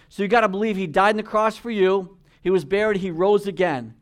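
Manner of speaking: preaching at full force. Real, not acted. Preacher exhorting the congregation not to compare, forceful and loud. Altar call to bowed heads.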